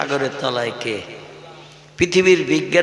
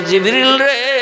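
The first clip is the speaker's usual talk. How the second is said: calling out; 8 semitones higher, 3 dB louder, slower than usual. Acted. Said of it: "আল্লাহ ডেকে বলছে".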